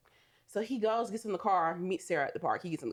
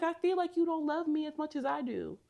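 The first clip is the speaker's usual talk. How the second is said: high, whiny imitation of crying; lightly imitated tearful complaint; mock tearful; 9 semitones higher than usual. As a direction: plead in a high, mock-weepy voice. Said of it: "And then she starts crying".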